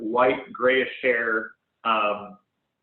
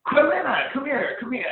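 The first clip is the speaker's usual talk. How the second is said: calling out; 13 semitones higher than usual. Acted. GM voices Ender calling.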